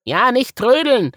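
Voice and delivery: high, loud bunny voice; Woggle echoing. A narrator's reading. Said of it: "wiederholte Woggle".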